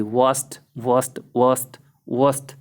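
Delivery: वास्ट ending in a crisp t, not d; ending with t sound. Shown as a demonstration. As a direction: say वास्ट with a clear final t. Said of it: "टै की sound आएगी". (वास्ट, अंत में टै की ध्वनि)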